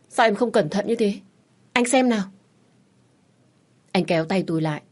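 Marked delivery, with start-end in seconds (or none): 0.1-2.2 worried scolding. A narrator's reading. Husband fusses over her cut.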